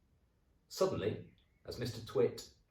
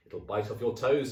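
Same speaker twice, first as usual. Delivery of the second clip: teasing husband; 5 semitones higher, 8 dB louder than usual. Acted. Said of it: "said Mister Twit".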